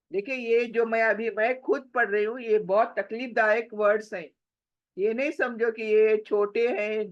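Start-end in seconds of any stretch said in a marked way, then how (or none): none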